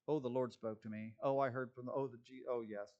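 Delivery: low, soft mock testimony; mocking insert. Preacher slips his own mockery into the quote, low mocking aside. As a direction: drop under your breath, mimicking a claimant. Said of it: "That was my little insert".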